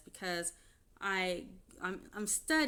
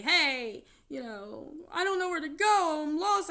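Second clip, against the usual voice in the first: higher, louder whiny plea; mocking ex-husband; 8 semitones higher, 8 dB louder than usual. Ex-husband calling her lost, higher whiny mock plea. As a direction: whine helplessly to mock him.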